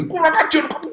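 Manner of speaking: half-acted rage at being bumped; retold angry outburst. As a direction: play the saint losing his temper. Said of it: "Ngamuk dia".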